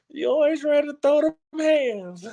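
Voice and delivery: high, teasing mock voice of a critic; a lightly voiced nagging critic. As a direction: poke fun at the people who say it. Mocking critics, laughing.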